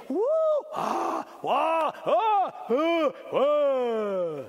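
high, loud crowd oohs and ahs; crowd cheering. Retold or imitated. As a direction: mimic the crowd cheering at the fireworks. Imitating the crowd at fireworks.

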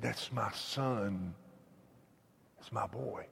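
soft, low, slow father's admission; a father's subdued words, quoted softly; quiet confession. Retold or imitated. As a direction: retell it hushed, voice dropped low. Old man's quiet confession about son.

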